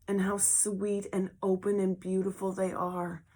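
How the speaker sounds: usual speaking voice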